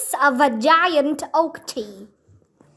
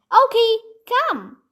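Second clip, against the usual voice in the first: higher, firmer call to the dog; calling command; 8 semitones higher than usual. Acted. Annie showing Jack her training, firm call. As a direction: command the puppy to come.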